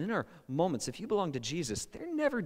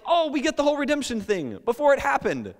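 much higher, louder eager claim; mock eager disciples; 13 semitones higher, 7 dB louder than usual. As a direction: overconfident disciples boasting. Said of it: "tell Jesus, like".

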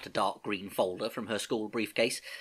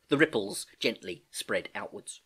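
plain reading voice

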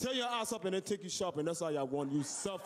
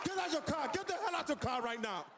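shouting cop; 12 semitones higher than usual. Acted. Cop yelling at a driver he pulled over, shouting. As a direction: bark it like a power-hungry traffic cop.

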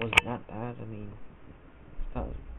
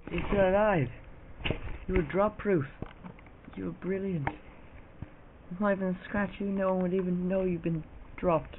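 high, doting praise like talking to a pet; fussing over the camera; 8 semitones higher than usual. Acted. Kid praises his dropped camera like a pet, high and doting. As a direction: coo over it as if it were a pet.

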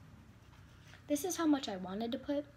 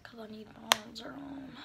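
ordinary talk, nothing marked

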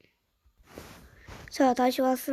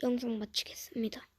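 soft whisper; 9 dB quieter than usual. Real, not acted. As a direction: whisper it aside. Soft aside. (속삭이며)

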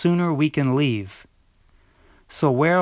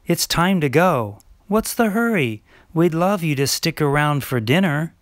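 ordinary talk

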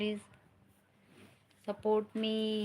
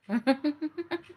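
a real laugh while chatting live; rising laugh; 6 semitones higher than usual. Real, not acted. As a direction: laugh climbing higher. Laughing after chatting with viewer.

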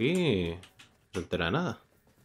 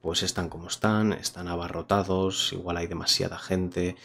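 voz de presentador, pausada; reseña a cámara; 2 semitones lower than usual. Acted. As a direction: cierra el vídeo con la opinión. Closing review to camera. (tono de reseña)

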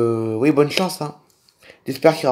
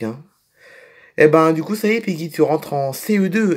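usual speaking voice

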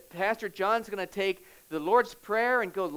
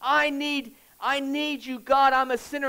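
higher, louder desperate plea to God; crying out; 5 semitones higher, 5 dB louder than usual. Acted. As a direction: cry out as a begging sinner. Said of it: "he cried out to God".

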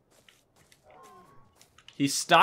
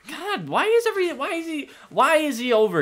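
exasperated whine; 11 semitones higher than usual. Real, not acted. Exasperated at the dinosaur's presence.

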